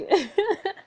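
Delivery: high embarrassed giggle; giggle, flustered. Real, not acted. Reader giggles.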